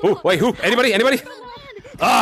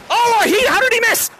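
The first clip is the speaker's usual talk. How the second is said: surprised outcry; 10 semitones higher than usual. Real, not acted. A player dodges the tag.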